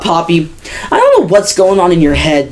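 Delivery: ordinary talk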